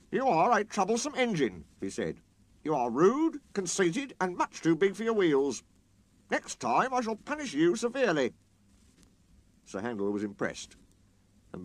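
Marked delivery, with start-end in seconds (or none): 0.1-1.6 severe. Acted. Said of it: "was severe with Sir Handel". 2.6-8.3 severe. Acted. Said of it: "was severe with Sir Handel".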